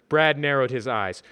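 usual speaking voice

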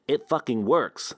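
lightly nasal, slow geeky mock; mock him as a nasal geek; hint of a whiny geek imitation. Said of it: "I mocked in my best nasal geek voice".

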